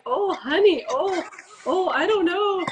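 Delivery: mother's high, dismayed Minnesota lilt; Minnesota accent. Retold or imitated. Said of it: "Minnesota accent".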